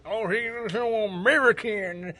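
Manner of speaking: mock hillbilly accent. Retelling a Danish player mocking Americans, slow hillbilly accent. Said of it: "He was trying to do a hillbilly voice".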